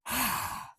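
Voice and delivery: long breathy exhale; audible sigh out. Shown as a demonstration. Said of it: "let it fall away".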